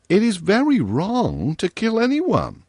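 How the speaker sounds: a hint of a kind little girl; young girl voice. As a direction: gently comfort him as a young girl. Virginia gravely scolds.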